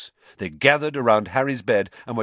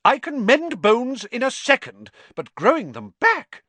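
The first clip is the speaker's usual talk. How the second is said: stern matron; 13 semitones higher, 6 dB louder than usual. Acted. Matron still scolding.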